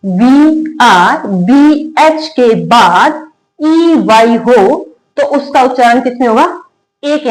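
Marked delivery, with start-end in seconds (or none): 0.1-4.4 each letter drawn out, very slowly; slow letter spelling. Shown as a demonstration. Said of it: "next है word में". (अक्षर-अक्षर बहुत धीरे बोलना)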